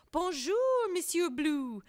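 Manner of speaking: a hint of French accent; French greeting. French ants greet Blue.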